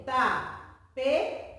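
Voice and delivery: "tapete" slowly as ta, pe, te; syllable by syllable. Shown as a demonstration. Teacher giving an example of trissílaba, "tapete" split slowly into ta-pe-te. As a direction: break "tapete" into three spaced syllables. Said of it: "vai ser separada".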